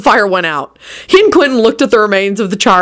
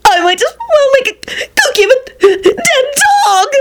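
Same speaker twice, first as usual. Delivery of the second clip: very high, wailing self-pity; howling. A narrator's reading. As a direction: wail it way up high like a drama queen. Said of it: "Loretta howled from the other end".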